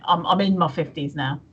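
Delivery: ordinary talk, nothing marked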